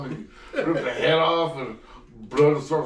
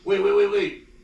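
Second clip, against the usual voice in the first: high, rushed repeated waits; mock urgent; 7 semitones higher, 3 dB louder than usual. Retelling the character stopping everyone, urgent. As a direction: hurry them, panicked. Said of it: "And he's like".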